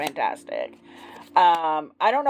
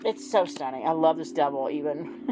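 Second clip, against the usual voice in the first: ordinary delivery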